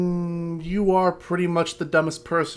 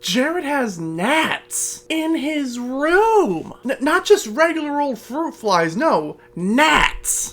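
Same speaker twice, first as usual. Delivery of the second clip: mocking disbelief; 7 semitones higher, slower than usual. Real, not acted. Mocking the vlogger's gnats.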